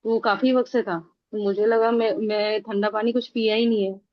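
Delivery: normal talking voice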